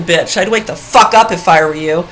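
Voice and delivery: ordinary talk, nothing marked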